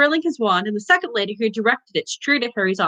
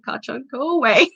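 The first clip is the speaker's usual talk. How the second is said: usual speaking voice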